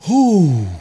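loud overcome exhale; a big shaky-free whew, moved by the song; emotional whew. Real, not acted. Preacher overcome by the love song, loud whew. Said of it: "I don't wanna get all emotional".

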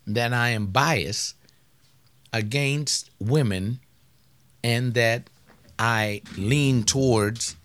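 normal talking voice

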